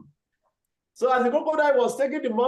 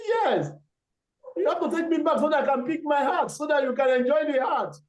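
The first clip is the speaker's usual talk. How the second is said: clever monkey; 6 semitones higher than usual. Acted. Monkey asks to go back.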